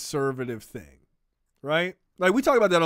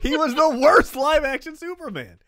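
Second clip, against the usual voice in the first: emphatic rant; 11 semitones higher, 4 dB louder than usual. Real, not acted. Repeating insult, loud and high.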